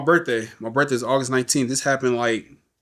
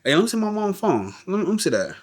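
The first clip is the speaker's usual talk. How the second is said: much higher, pressing request; urgent request; 8 semitones higher than usual. Retold or imitated. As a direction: grab for the phone urgently. Urgently wanting mom's phone.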